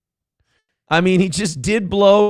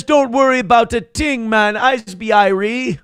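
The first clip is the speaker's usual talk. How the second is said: higher mock Jamaican accent, hammed up; playful put-on Jamaican reading; Jamaican patois; 6 semitones higher than usual. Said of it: "He says".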